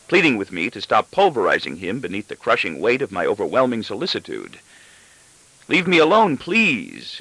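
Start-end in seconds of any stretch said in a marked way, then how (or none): 5.7-7.2 higher, louder desperate plea; fierce scream. Acted. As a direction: shout to be left alone. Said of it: "he is actually screaming at me fiercely".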